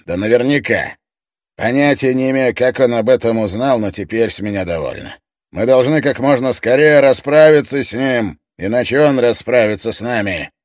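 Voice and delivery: loud, high furious demand. Acted. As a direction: hammer it in, furious.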